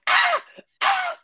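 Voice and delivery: high hammed-up "Ah! Ah!" screams; mock screams. Shown as a demonstration. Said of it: "a screamer to me is like".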